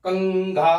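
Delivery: कंघा said loudly with the nasal clearly sounded; loud demonstration. Shown as a demonstration. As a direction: call out कंघा so the class hears its nasal. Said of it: "इसको". (कंघा का ज़ोर से उच्चारण)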